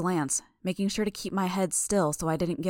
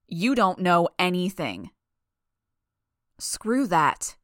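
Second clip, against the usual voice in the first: loud, angry contradiction; heated rebuttal; 5 dB louder, slower than usual. A narrator's reading. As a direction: throw it back forcefully. Heated exchange in acted quarrel.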